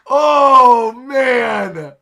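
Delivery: very loud, drawn-out wail of delight; thrilled, laughing fan meltdown; overwhelmed outburst. Real, not acted. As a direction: let a huge slow "oh man" burst out. Overwhelmed reaction to the romance.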